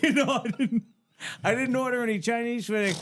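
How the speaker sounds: high-pitched mock protest; retold exasperation; exasperated denial. Called man protests to prank callers.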